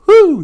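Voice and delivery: sudden high woo in the song; high loud woo; high whoop. Retold or imitated. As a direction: whoop like a teasing sibling. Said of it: "start singing it".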